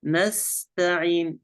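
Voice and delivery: نستعين slow with a thin, breath-released t; light ta. Shown as a demonstration. Sheikh models the correct ta, slow and light. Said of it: "حروف تاء تبس".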